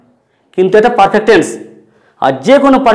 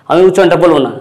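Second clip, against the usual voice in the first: normal talking voice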